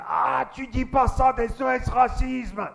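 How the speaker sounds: high, slow shouted protest; hammed-up indignation, loud and drawn out; mock angry outburst. Jean-Jacques losing his temper at the comic, mock fury. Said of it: "il s'énerve".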